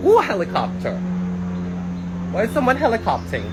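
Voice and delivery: high, loud surprised exclamation. Real, not acted. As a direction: react to the sudden helicopter. Surprised by helicopter noise.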